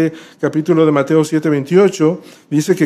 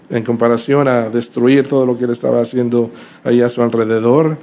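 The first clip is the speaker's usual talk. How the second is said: ordinary talk, nothing marked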